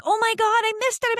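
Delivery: high-pitched exasperated outcry; mad at herself. Retold or imitated. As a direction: wail at your own blunder, re-enacted. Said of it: "I was so mad".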